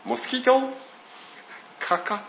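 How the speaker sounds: hammed-up confused American mishearing; puzzled Americans. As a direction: imitate Americans mishearing the name. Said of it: "彼らは".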